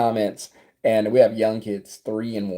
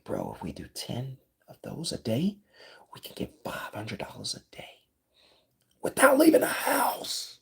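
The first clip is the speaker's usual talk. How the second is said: excited whispered self-talk, slowing at the end; whispering inside head; 9 dB quieter than usual. Acted. Scheming to himself about daily earnings, whispering. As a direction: whisper it like a secret thought. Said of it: "I was whispering inside my head".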